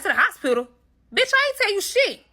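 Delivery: shocked, outraged, way up high; indignant disbelief, high and loud; incredulous. Retold or imitated. Incredulous reply to the friend.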